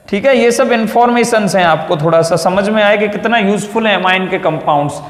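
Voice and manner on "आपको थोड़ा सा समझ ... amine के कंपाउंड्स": ordinary talk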